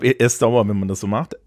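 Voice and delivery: ordinary talk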